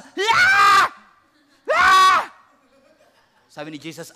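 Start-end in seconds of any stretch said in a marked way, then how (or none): 0.0-2.3 much higher, very loud enraged scream; the high priest shrieking in rage; furious scream. Acted. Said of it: "kung ako yung artista".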